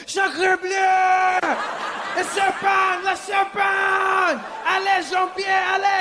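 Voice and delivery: high, hammed-up French cries with long vowels; panicked French man. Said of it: "The French guy was my favorite".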